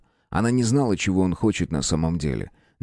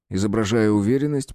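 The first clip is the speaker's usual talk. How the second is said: usual speaking voice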